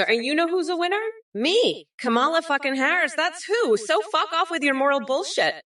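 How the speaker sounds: high-pitched, indignant boast turning to a brush-off; ranting at a chat heckler, pitched high; worked-up rant. Acted. Mock political rant at a chatter.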